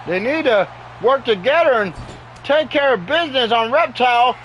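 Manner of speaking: normal talking voice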